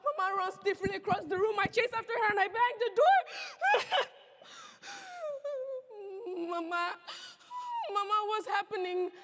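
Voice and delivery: high frantic cries; panicked shouting. Acted. Girl clinging to Papa as mob attacks, frantic.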